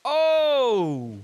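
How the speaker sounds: loud high amazed shout; excited oh. Real, not acted. Watching the worm at the mic, a loud high amazed cry. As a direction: cry out as the move lands. Reacting to dance moves.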